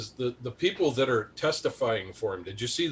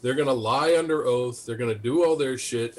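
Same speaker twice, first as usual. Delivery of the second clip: ordinary delivery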